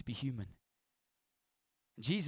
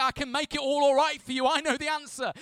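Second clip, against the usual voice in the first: overeager pastor promise; 13 semitones higher, 10 dB louder than usual. Pretend.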